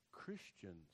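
low, hushed thought to oneself; retold quiet realization. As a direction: drop to a soft, sober murmur. Retold dawning thought, looking down.

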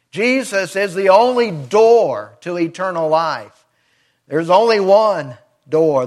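usual speaking voice

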